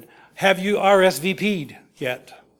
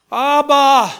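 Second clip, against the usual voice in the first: high, loud cry of Abba; anguished cry to God; 8 semitones higher, 7 dB louder than usual. Acted. Jesus crying to the Father in the garden, anguished.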